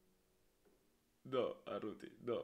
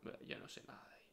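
quick whisper; 10 dB quieter, much faster than usual. Real, not acted. Tense moment in game.

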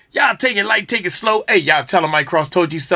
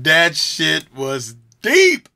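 drawn-out awe; 3 semitones lower, much slower than usual. Real, not acted. Stunned reaction after the song.

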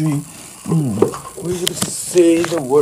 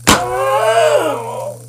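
high victory shout; 13 semitones higher, 4 dB louder than usual. Pretend. Victory cry after the fight.

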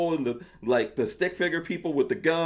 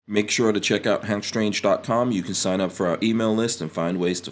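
lower, louder announcer sign-off; promo read; 6 semitones lower, 3 dB louder than usual. Acted. Host signs off by plugging the website, announcer style.

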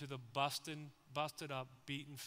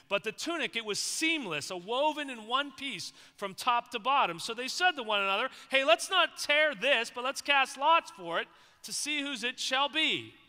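loud, higher, fervent Bible reading; preaching scripture reading; 8 semitones higher, 12 dB louder than usual. Acted. Preacher reading John to the church, loud and fervent. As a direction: proclaim the passage to the congregation. Reads scripture at full force.

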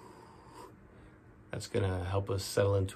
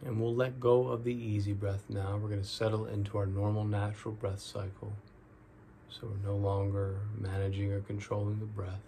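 guided meditation; 7 dB quieter than usual. Real, not acted. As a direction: ease them into each breath, drawing out the key words.

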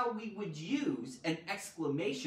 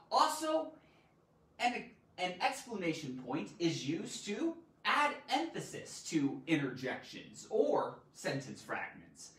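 ordinary talk

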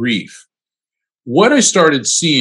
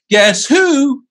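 very high, loud cartoon-bird "Guess who?"; high squeaky peekaboo call; playful cartoon voice; 13 semitones higher, 4 dB louder than usual. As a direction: tease it like a cartoon character popping in. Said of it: "and he said".

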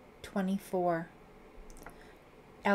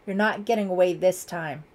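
loud, threatening declaration; shouted; 9 dB louder than usual. A narrator's reading. Lieutenant threatens cornered suspect, shouting. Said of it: "he shouted".

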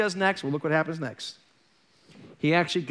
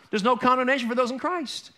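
ordinary talk

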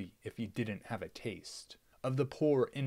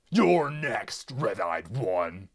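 with intent; 9 dB louder, slower than usual. Acted. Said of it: "Piglord said with intent".

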